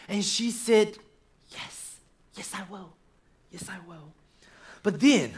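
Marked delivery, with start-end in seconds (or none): 1.5-4.1 soft yes. Acted. Woman accepting proposal, soft voice.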